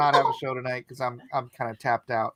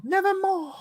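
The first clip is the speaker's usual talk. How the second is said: theatrical raven quote. Pretend. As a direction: croon it high like a hammy raven. Hammy Poe raven quote.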